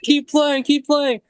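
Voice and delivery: high, pleading mock whine; hammed-up demand that the band keep playing; upset homeless lady. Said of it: "she got super upset and was like".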